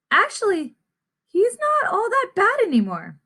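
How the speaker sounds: high, chirpy bystander voice; second gossiping seed. Acted. Another seed correcting the gossip, high character voice. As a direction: pitch up into a small gossipy character.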